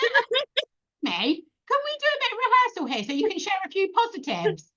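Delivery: put-on high, incredulous squeak at the girls; mock outraged disbelief. Mother mock-protesting to her daughters, high incredulous.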